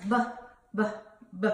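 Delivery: "B" as a short buh, not the letter name; letter sound demo. Shown as a demonstration. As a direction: sound out "B" as separate quick bursts. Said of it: "Bubba Bear says".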